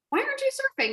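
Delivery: squeaky exaggerated taunt; mocking high. Shown as a demonstration. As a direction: pitch it way up like a sneer. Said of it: "didn't say in a way of like".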